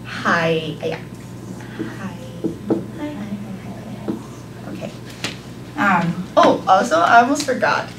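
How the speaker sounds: ordinary talk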